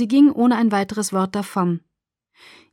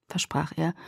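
ordinary delivery

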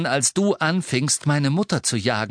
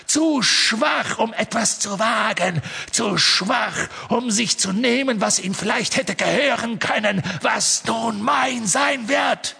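Voldemort; 9 semitones higher than usual. Acted. Voldemort boasts, rising to shout.

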